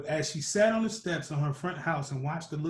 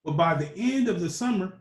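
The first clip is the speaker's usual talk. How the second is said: usual speaking voice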